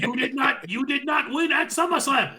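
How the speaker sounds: high, loud mock yell at her rival; imitating a yell. Rhea yelling at Asuka, loud mock yell. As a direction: bark it at her, hammed-up. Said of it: "she's on her greatest Mister Fuji impression".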